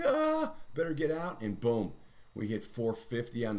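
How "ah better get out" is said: higher, louder mock alarm; short sellers. Trader mocking short sellers as a higher, louder panicked voice. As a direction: play the spooked short seller bailing out. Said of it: "Shorts are like".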